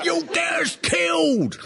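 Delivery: high, slow mock panicked cry; high-pitched mock fearful shout; alarmed wife. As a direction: squeal it like a scandalised old wife. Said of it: "his wife was going".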